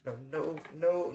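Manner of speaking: normal talking voice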